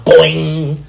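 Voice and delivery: cartoon ricochet "boing" with a ringing tail; imitated boing. Man recalling a thrown chancla hitting him, comic sound effect. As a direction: punch out a springy impact noise.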